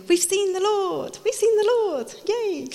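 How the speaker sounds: high, gleeful announcement; excited disciples. Retold or imitated. Disciples announce the risen Lord.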